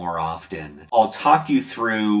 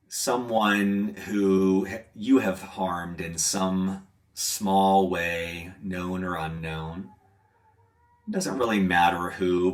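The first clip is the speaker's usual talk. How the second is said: guided meditation; 4 dB quieter than usual. Acted. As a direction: gently lead into reflection, leaving space.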